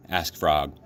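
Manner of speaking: plain reading voice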